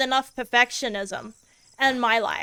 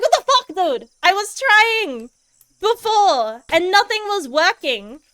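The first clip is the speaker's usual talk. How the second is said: high, loud mock indignation; retold outrage; 6 semitones higher, 4 dB louder than usual. Streamer replaying her reaction to the boss finally dying, mock outraged. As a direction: act out disbelief at winning. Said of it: "And I was like".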